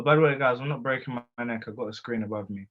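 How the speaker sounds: ordinary talk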